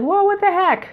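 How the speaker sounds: replaying the moment he felt the energy move; amazed disbelief. Telling the listener how he reacted at the squat rack, amazed. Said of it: "But I was just like".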